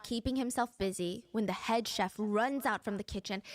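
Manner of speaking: normal talking voice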